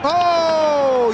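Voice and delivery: surprised exclaim. Real, not acted. Wicket taken, sudden exclaim.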